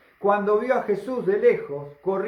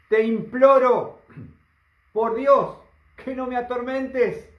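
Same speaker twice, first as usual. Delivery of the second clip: loud, slow, anguished begging, pitched up; demon pleading; 6 semitones higher, 5 dB louder, slower than usual. Acted. The demon loudly begs Jesus not to torment him. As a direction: beg for mercy, drawing it out.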